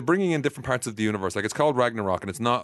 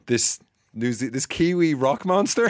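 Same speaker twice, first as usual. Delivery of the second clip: put-on slow, high Kiwi accent; New Zealand accent; 4 semitones higher, slower than usual. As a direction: mimic the New Zealand drawl. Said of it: "The accent makes it".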